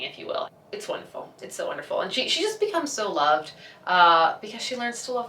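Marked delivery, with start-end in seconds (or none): 0.0-2.0 soft gushing. Real, not acted. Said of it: "soft gushing aside".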